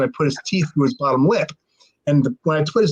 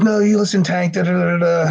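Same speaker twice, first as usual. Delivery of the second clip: higher-pitched mock babbling protest; drunken argumentative rant; 4 semitones higher than usual. Chad refusing to listen to the narrator, argumentative mock rant. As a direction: talk over him, trailing into nonsense syllables. Retelling Chad cutting him off.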